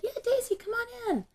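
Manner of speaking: high, singsong invitation; motherly call. Retold or imitated. Mother calls daughter into the reading room, high-pitched.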